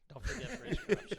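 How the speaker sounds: loud, high fake indignation; mock outrage. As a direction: ham up the outrage.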